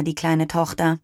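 ordinary delivery

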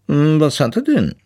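high walrus character voice; weises Walross. A narrator's reading. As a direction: ask it as a walrus, pitched high. Walrus figure asks about the son. (hohe Walrossstimme, fragend)

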